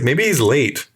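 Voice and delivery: usual speaking voice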